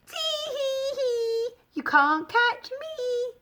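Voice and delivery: high, slow giggly little voice; giggling gingerbread man. A narrator's reading. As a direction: tease them with a high sing-song giggle. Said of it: "he giggled".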